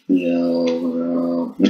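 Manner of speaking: drawn-out deep-voice greeting; lightly deepened, lengthened words; put-on low voice. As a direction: stretch it out in a mock deep voice. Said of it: "to really low now".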